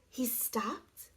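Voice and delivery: hushed suspense whisper. A narrator's reading. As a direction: whisper it like a secret. Suspense narration.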